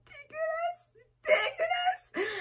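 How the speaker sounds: high wailing cry of disgust. Real, not acted. Vlogger reacting to kombucha taste, squealing disgust. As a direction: squeal it like it's the worst taste ever.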